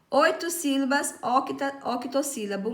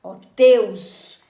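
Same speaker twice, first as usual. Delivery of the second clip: "teus" punched out much louder; stress on TEUS; 3 semitones lower, 11 dB louder than usual. Shown as a demonstration. Teacher showing the class a strong syllable, heavy stress on TEUS. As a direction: hit "teus" hard as one strong syllable. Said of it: "é uma sílaba forte".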